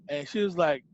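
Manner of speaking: usual speaking voice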